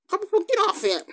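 high-pitched telling-off; playful scolding. Pretend. Telling Copper Paw off the egg, scolding. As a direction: scold a naughty pet in a high voice.

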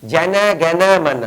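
জ্যানা জ্ঞানা মানা said with জন turned into জ্যানা, mimicking it; mock the anthem sung wrong as জ্যানা জ্ঞানা মানা; mocking mispronunciation. Speaker mocking TV singers' জ্যানা জ্ঞানা মানা, mimicking. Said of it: "জাতীয় সঙ্গীত উচ্চারণ করছে".

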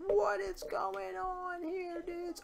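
slow, high-pitched bewilderment; baffled squeak. Real, not acted. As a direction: whine it up high, confused. Buttons vanish, baffled.

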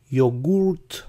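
"yogurt" as yo-GOORT, with an oo vowel; wrong quiz option. Shown as a demonstration. Teacher offers a decoy choice, "yogurt" as yo-GOORT. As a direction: say "yogurt" with "goort" in the second syllable. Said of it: "¿Cómo se pronuncia yogurt en inglés?".

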